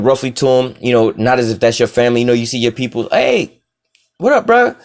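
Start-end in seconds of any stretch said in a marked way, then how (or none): 3.1-4.7 high, bright hey bro, pitched way up; excited greeting. Retold or imitated. Joseph greeting his brothers warmly, excited and high. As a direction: call out to a brother you're thrilled to see.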